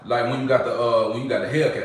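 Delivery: usual speaking voice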